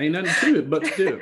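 put-on laughing folksy Southern accent. Host joking along, put-on Southern accent. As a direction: toss it out in a playful Southern accent. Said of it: "the commitment to the accent".

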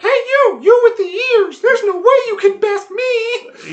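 very high, loud goblin puppet squeal; little taunting voice. Acted. Goblin puppet taunting a player to beat it, little squeaky voice. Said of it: "a little voice like this".

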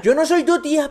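mock offended, high; high retort. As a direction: snap back in a high voice. Retort in character.

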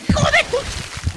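startled yelp at the cold; cursing as icy water hits; in ice cold water. Real, not acted. Icy water hits his hands.

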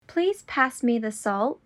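ordinary talk

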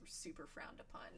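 whispered aside. Real, not acted. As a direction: drop to a whisper for the tail. Aside about snobby restaurants.

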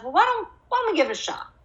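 high, quick, coaxing voice; playful high coaxing. Retold or imitated. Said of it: "I was like".

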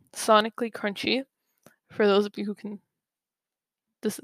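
ordinary talk

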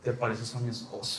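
purring the line very low and soft; low, soft mock flirt. Quoting the older lady.

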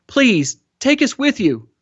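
high, straining appeal; desperate pleading. A narrator's reading. Boy fighting off fingers to stay on dinghy, pleading. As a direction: plead harder, pitch climbing steeply. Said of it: "He sobbed with the effort".

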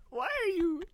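laughing until near tears at a friend; crying laughter. Real, not acted. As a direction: gasp it out while crying with laughter.

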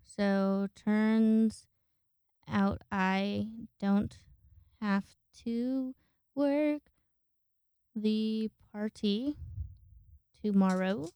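reading a text aloud, slowly; slow dictation. Shown as a demonstration. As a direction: spell the message out word by word. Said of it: "gonna tell you guys what I'm texting".

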